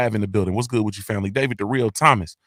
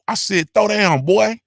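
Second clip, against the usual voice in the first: imitating old gangster; 8 semitones higher, 3 dB louder, slower than usual. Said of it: "Winston Lee go smack him".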